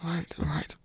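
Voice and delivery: soft self-soothing whisper; calm yourself under your breath. Acted. A nervous god whispering to steady himself.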